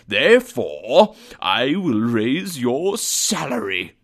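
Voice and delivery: slow, drawn-out grand pronouncement with swooping pitch; slow, teasing mock severity; mock-stern announcement. A narrator's reading. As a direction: build loudly on the first word, then spin out the reveal. Mock-stern build to surprise reveal.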